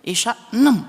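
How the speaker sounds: sudden high, loud exclamation of disbelief; incredulous exclamation. Retold or imitated. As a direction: blurt it out in amused disbelief. Mock answer, laughing at absurdity.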